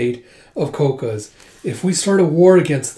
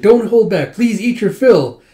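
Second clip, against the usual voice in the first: eager host; 6 semitones higher, 6 dB louder than usual. Retold or imitated. Host urging her guests to eat, eager and loud. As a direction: push the food on them eagerly.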